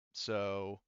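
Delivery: ordinary delivery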